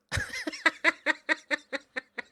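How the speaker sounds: high, gleeful laugh; delighted laugh. Real, not acted. Laughing at striker's record.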